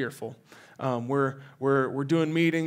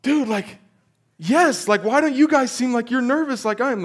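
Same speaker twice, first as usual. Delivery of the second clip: high, loud, frantic; panicked disbelief; 10 semitones higher, 7 dB louder than usual. Retold or imitated. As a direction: blurt it out, wound up with nerves. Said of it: "And I'm like".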